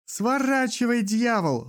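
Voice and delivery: a high yell out of the dark; shouting from the dark. A narrator's reading. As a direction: yell it from far across the street. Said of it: "раздаётся в потёмках".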